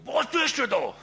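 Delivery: shouted high and loud, far from his own voice; yelled demand. Retold or imitated. Angry restaurant man yells at the photographer, shouting. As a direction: bark it like an angry local. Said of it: "und schreit mich an".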